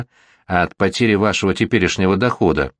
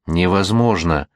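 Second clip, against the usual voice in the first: plain reading voice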